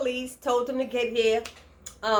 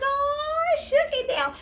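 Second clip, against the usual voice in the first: high, amazed "Oh"; shocked reaction; slower than usual. Retold or imitated. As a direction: gasp at the fight. Reacting to the slap fight.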